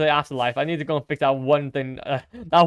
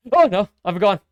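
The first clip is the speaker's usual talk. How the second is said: usual speaking voice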